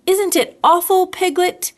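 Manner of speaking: a bear gently grumbling about the rain; Pooh. Acted. Pooh voiced in story.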